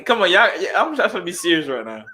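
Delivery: trying not to laugh; breaking up with laughter. Real, not acted. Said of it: "we can't laugh".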